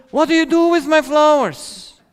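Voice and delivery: mock-outraged boyfriend; angry boyfriend. Boyfriend angry over his thrown-away flowers, indignant and high. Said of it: "if he gets angry on you and says".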